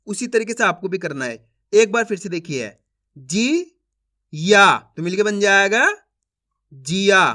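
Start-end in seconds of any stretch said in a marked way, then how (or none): 3.2-4.8 "जी ... या" as separate sounds with a pause between; syllable by syllable. Shown as a demonstration. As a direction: sound out जी, then या on its own. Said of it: "एक अक्षर का उच्चारण करके". (जी और या अलग-अलग बोलकर)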